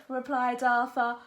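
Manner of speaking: usual speaking voice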